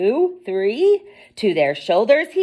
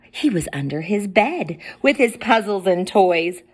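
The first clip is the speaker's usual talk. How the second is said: usual speaking voice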